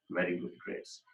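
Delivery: ordinary delivery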